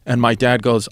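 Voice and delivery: normal talking voice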